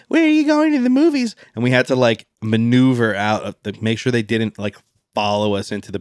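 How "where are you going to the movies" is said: high mocking teen girl voice; mall goth girl voice. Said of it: "this chubby mall goth girl was like".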